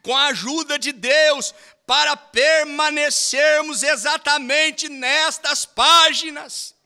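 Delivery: fervent preaching, high and loud; preaching at full force. Acted. Preacher urging the church to want the whole Bible, fervent preaching. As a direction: preach it to the congregation with fire.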